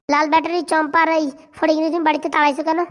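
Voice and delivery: usual speaking voice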